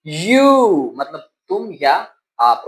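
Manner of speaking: ordinary talk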